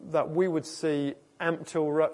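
ordinary talk, nothing marked

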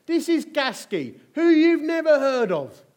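loud, high, ringing sermon voice; preaching at full force. Acted. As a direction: proclaim it to the whole hall.

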